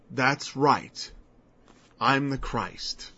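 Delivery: slow, soft solemn claim; Jesus calmly affirming he is the Christ; solemn affirmation. Acted. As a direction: state it quietly and firmly.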